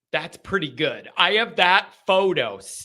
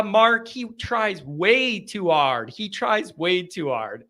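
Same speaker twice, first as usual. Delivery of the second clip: normal talking voice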